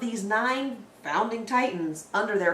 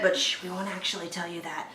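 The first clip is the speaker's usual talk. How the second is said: hushed, quick secretive aside; conspiratorial aside; 2 semitones lower, 8 dB quieter, faster than usual. Acted. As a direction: drop the voice to share a secret quickly.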